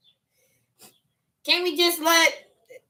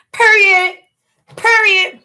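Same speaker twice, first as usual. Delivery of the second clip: emphatic hype; 5 semitones higher, 6 dB louder, slower than usual. Real, not acted.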